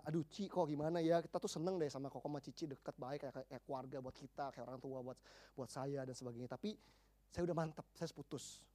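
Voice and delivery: put-on low, soft retelling of a girl's confession; subdued quote of a young woman opening up; quiet confiding. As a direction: voice her confession softly and low. Girl confiding a breakup to counsellors.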